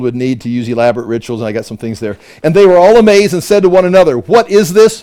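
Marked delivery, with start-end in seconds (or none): 2.4-5.0 raised, louder proclaiming voice; loud, high-pitched dramatic reading; amazed scripture reading. Acted. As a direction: read it out with the crowd's astonishment. Reads the crowd's amazement aloud.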